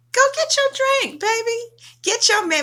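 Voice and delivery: high-pitched, playful party voice; high party cheer. Real, not acted. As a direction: hype up your drinking buddy.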